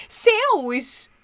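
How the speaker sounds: a surprised mother's exclamation; surprised mum. A narrator's reading. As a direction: mum caught off guard. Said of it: "disse a mãe surpresa".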